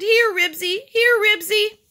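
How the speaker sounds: high, loud dog call; high-pitched repeated calling. A narrator's reading. Woman calling the runaway dog.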